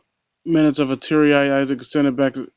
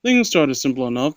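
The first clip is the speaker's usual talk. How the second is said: usual speaking voice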